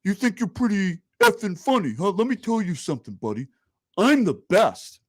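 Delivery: hammed-up Andrew Dice Clay swagger; mock Dice Clay tough guy. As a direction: play him as a cocky comic putting a heckler down. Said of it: "And then he goes".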